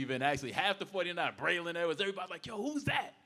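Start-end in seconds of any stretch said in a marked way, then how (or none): 2.4-3.1 high-pitched, eager call; imitated excited onlookers. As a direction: mimic a group suddenly noticing someone. Everybody reacting to the woman.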